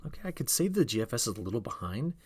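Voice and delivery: ordinary delivery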